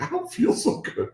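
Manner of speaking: high, weak sick voice; queasy. Retold or imitated. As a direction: play it sickly and queasy. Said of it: "she's like".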